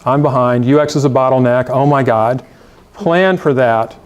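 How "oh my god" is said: ordinary delivery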